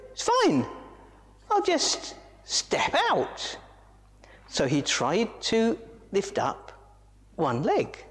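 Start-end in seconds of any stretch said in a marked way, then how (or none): 0.1-3.6 high squeaky monkey voice, brightly brave; little monkey insisting he's fine; Moto the monkey. Acted. Monkey voice in story.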